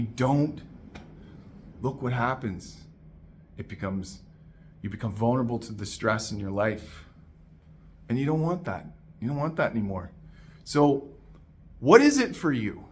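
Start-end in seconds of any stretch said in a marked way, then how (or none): none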